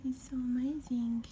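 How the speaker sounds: soft excited whisper; whispering in delight at the toy. Real, not acted. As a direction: hush it, full of wonder. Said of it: "I don't know why I'm doing this voice".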